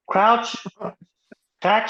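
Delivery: imitating a ref with an Australian accent; mock Aussie ref. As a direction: bark the scrum calls in an Aussie accent. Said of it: "Australian accent".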